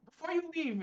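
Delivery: calling out as wizard. Acted. Player voices wizard calling out.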